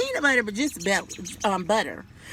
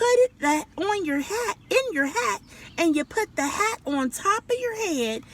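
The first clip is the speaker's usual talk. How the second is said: higher, firm motherly scolding; lecturing mama; 6 semitones higher than usual. A narrator's reading. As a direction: teach the silly child how it's done. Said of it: "said Mama".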